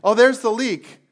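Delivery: loud, high, overeager rescuer; hammed-up do-gooder spotting the leak; mock eager helper. Preacher mocking the overeager rescuer, loud mock eagerness. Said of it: "you go".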